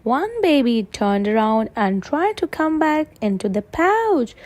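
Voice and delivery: plain reading voice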